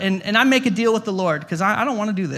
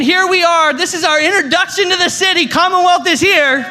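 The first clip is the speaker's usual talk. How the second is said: loud, high-pitched proclamation; grand announcement; 9 semitones higher, 6 dB louder than usual. Acted. Church planter introducing his church to the city, loud grand announcement. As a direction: announce it to the whole city at a shout.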